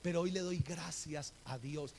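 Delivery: usual speaking voice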